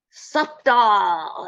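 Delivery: high, loud cat call; cat's cry. Acted. Witch cat taunts Jack over the gravy, high loud cry. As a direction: cry it out high, stretching the last word.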